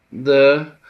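a frightened stammered "De", loud and high; pale stammer. Acted. Envoy falters before his lord, stammering in fear. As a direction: catch on the first word in fear. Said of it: "hebegte sápadtan Aguf".

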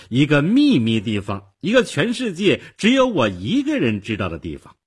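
put-on loud, high, boastful little girl; triumphant, crowing girlish voice; very smug. A narrator's reading. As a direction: show off your secret proudly. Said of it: "小姑娘非常得意地说".

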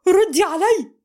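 loud, high yell; shout. A narrator's reading. Mohamed screaming at silent Amal to answer. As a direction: scream the order at her. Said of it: "فصرخ فيها".

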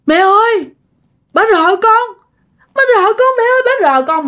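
high, thin-voiced imitation of a scared little boy; frightened child. Scared son calls to his mother outside the toilet, frightened high voice. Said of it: "nó sợ á các bạn".

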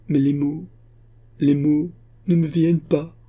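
high, halting, strained voice; words failing. A narrator's reading. Exhausted daughter cannot tell her father, words failing. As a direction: struggle to get the words out. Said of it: "sans produire aucun son".

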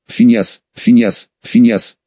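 low repeated call, falling each time; calling out name. A narrator's reading. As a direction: call his name three times, low and firm. Calling sleeping portrait.

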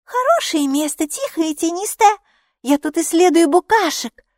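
put-on high, bright little girl. A narrator's reading. Girl explaining herself to the neighbour, childlike. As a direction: play the small girl explaining.

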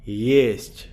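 distant, matter-of-fact echo reply; flat echo voice. A narrator's reading. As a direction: answer back like a casual echo. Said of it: "Буднично ответило оно голосом".

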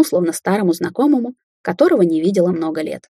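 ordinary delivery, nothing marked

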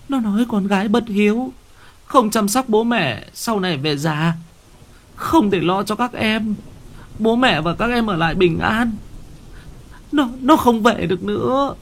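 high, fast, frightened rush of words; terrified wife. Acted. Wife tells husband she saw their daughter's ghost, high and panicked.

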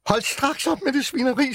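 high furious screech; wolf shrieks in disgust at the pig; shrieking wolf. Acted. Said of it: "skreg ulven".

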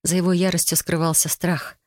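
usual speaking voice

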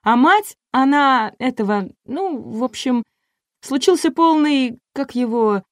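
высокий голос запинающейся девицы; подбирать слова, мямля на высоких нотах; растерянная певица. A narrator's reading. Певица мямлит, забыв слова роли. (растерянно, высоким голоском)

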